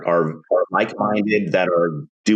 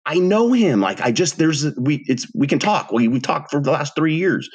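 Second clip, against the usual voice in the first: retold high defensive protest; defensive insistence; 9 semitones higher than usual. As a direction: protest that you know him well. Defending himself to wife.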